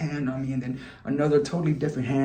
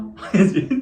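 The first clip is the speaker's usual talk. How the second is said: laugh; 7 semitones higher, 6 dB louder than usual. Real, not acted. Awkward moment laugh.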